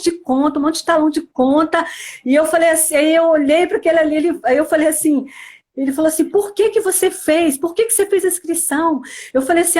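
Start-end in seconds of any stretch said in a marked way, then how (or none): 6.3-9.0 husband's scolding. Retold or imitated. Husband scolding her about retreat.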